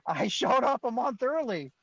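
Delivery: high, drawn-out, laughing squeal; amused disbelief. Real, not acted. Admitting to his own timing blunder, laughing and incredulous.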